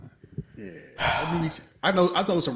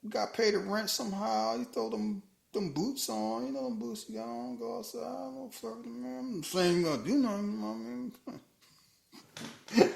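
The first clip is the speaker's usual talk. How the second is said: high, stammering backpedal; nervous backpedal; 10 semitones higher than usual. Retold or imitated. Reed backpedaling to his wife Sue, high nervous stammer. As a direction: squirm out of what you just said.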